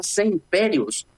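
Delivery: ordinary talk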